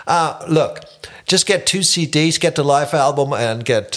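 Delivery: lightly voiced casual manager in English; breezy pitch to the new guitarist; band manager on phone. Manager calling Markus about the gig, casual English. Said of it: "an den Manager".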